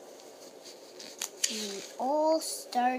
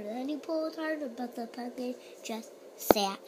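ordinary delivery, nothing marked

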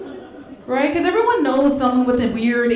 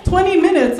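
normal talking voice

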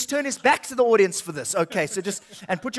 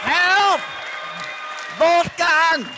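1 mock cry for help; 6 semitones higher, much slower than usual. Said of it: "You gotta go".

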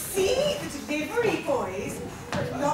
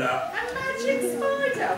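surprised; 8 semitones higher than usual. Acted. Surprised at a magic spider.

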